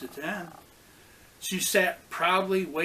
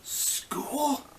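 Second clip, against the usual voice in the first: high, breathless question in disbelief; astonished one-word question; disbelieving gasp; 9 semitones higher than usual. A narrator's reading. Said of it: "Beatrice gasped in disbelief".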